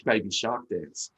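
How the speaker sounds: normal talking voice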